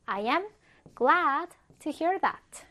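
"I am", pause, then a drawn-out "glad to hear that"; "glad" stretched out for learners to follow; slow model sentence. Shown as a demonstration. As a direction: say "I am glad to hear that" slowly in separate chunks. Teacher models sentence in slow chunks.

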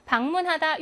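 usual speaking voice

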